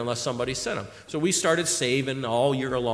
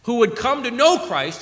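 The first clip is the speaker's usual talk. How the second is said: ordinary delivery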